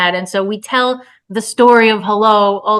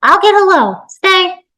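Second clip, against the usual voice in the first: put-on raised, loud call to a child; 10 semitones higher, 4 dB louder, slower than usual. Woman tells the toddler to stay, calling out. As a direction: call it out higher and firmly.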